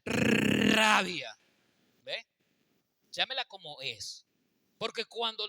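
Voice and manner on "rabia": Rabia with a hard, rolled R, said very loud; Rabia, rolled R, loud and forceful; with a strong R. Shown as a demonstration. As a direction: roll the R of Rabia hard and punch it. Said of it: "lo dice así con la R".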